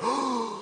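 exaggerated scandalised gasp; mock shocked gasp. Preacher mimics listeners offended by strong word, shocked gasp.

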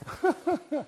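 short high chuckle; brief, high laugh. Real, not acted. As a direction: chuckle lightly. Preacher laughs before resuming.

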